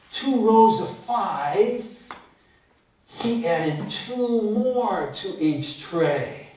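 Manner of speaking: slow, drawn out, each word apart; slow teacher count. Shown as a demonstration. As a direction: spell it out slowly for the kids. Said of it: "storyteller slows for counting".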